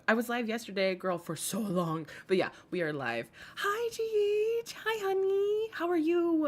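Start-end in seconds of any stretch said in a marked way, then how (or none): 3.6-6.5 sweet greeting. Real, not acted. Greeting a viewer on stream.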